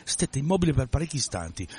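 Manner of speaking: usual speaking voice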